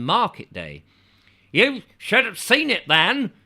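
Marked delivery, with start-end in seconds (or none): 1.5-3.3 high, loud proud townsman voice; boasting local man, voiced up high. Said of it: "he said".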